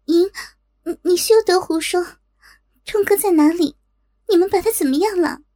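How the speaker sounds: high, agitated, stammering start; trembling voice. A narrator's reading. Captive demands to know her lover's fate, trembling. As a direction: demand news of him in rising distress. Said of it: "忍不住颤声道".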